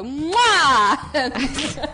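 loud smacking air kiss; blowing an air kiss. Retold or imitated. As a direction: blow a big loud kiss. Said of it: "I would go".

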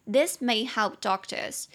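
slow, careful repeat; slow model reading. Shown as a demonstration. Teacher repeating the phrase slowly for learners. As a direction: say it slowly so students catch it. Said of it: "repeats phrase slowly".

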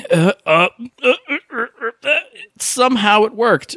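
high, rapid uh-uh-uh, then relief; frantic stammer. Retold or imitated. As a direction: stammer through a scramble that somehow works. Frantic scramble through a level.

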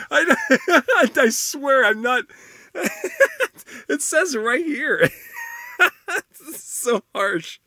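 high giggling through the words; can't stop laughing at it. Real, not acted. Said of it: "I'm laughing 'cause that sounds so harsh".

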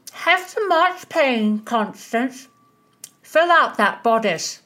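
high, slow, sweet-toothed teasing voice; teasing greedy friend. A narrator's reading. Mary teases Constance with sweets, high and slow. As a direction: coax her to eat, drawn out and high.

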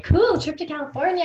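high, loud retold enthusiasm; perky high-pitched reaction; excited. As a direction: act out being thrilled at the invite. Retells own reaction to trip offer.

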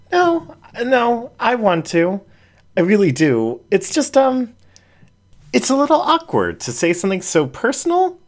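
bashful raccoon easing into a personal story; Jen, shy raccoon voice. A narrator's reading.

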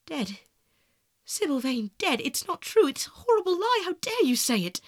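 building from stunned question to high, loud denial; pained cry. A narrator's reading. Lad refuses news of his beloved's death, pained outcry. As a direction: cry out in anguished protest. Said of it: "A cry of pain broke from the lad's lips".

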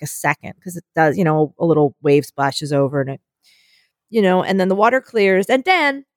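ordinary talk

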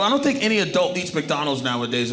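ordinary delivery

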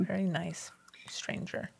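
soft unvoiced whisper. Real, not acted. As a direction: breathe it as a whispered aside. Whispered aside about stranger.

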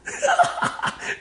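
breathy, unforced chuckling; real giggle; amused laugh. Real, not acted. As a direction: laugh at your own naivety. Laughs at his own naive question.

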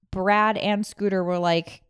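usual speaking voice

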